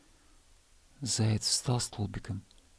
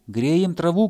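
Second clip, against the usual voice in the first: higher, louder reply; shouting; 7 semitones higher, 7 dB louder, slower than usual. Acted. Hare shouting up to the hedgehog. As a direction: yell up the hill. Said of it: "крикнул заяц".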